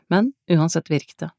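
plain reading voice

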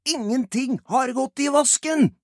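loud, high-pitched insistence; shouted protest. Acted. Albert denies that the plan has failed, shouting. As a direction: yell it back in high, loud denial. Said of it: "roper Albert".

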